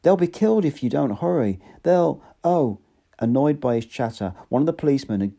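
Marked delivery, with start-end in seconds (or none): 0.0-2.8 frantic plea. A narrator's reading. Bernard pleading frantically with police.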